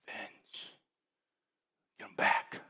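hushed whisper of Revenge, Get 'em back. Acted. Pastor voicing the flesh's wish to the church, in a whisper. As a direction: whisper it like a secret temptation. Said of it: "whispered aside".

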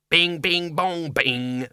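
high sing-song imitation of a chime; demon chime. A narrator's reading. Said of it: "said the demon".

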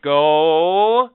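slow, loud over-enunciation. Shown as a demonstration. Talking down slowly to a non-English speaker, loud and drawn-out. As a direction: spell it out slowly like to a child. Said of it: "not talk slow".

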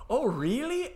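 high, loud mock reaction; imitating Geordie contestant. Co-host acting out contestant's task reply, high mock disbelief. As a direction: raise it high and loud, mimicking him. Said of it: "Chris Ramsey has to go".

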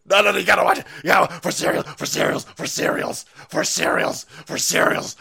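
loud, choppy put-on voice; goofy mock insistence. Host urging viewers to watch, goofy insistent voice. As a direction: insist over and over in a silly loud voice.

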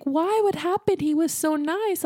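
high, shocked squeal of disbelief. Retold or imitated. Friend at the gym hears of the breakup, high shocked disbelief. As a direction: gasp it out as if the news can't be true.

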